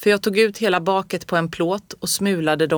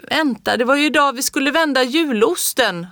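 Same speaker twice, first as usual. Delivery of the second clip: calling out; 7 semitones higher than usual. A narrator's reading. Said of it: "hörde jag farmor ropa".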